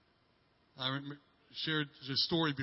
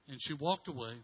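ordinary talk, nothing marked

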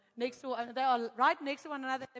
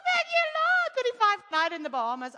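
much higher, loud praise; joyful thanks; 10 semitones higher, 8 dB louder than usual. Retold or imitated. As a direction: burst out thanking God. Cheap room, joyful thanks.

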